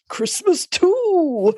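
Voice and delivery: high, slow, drawn-out exclaim of Christmas too; quoting Dudley Moore. Retold or imitated. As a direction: stretch it out high and slow like a gleeful movie line. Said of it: "he's like".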